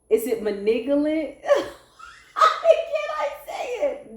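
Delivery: giggling through the word; laughing question. Real, not acted. Reader checking the word, laughing. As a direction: ask it while cracking up. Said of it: "I'm laughing because".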